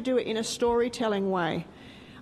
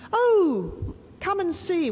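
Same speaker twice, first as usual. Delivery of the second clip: eager call; 7 semitones higher, 4 dB louder, slower than usual. Acted. Mourners answer Jesus in story.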